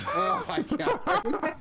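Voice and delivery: high, slow, laughing oh my God; laughing disbelief. Real, not acted. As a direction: crack up in disbelief. Reacting to weird tweets.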